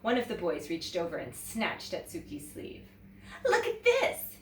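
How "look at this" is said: high, loud teasing call; a jeering schoolboy; mocking boy. A narrator's reading. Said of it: "he said".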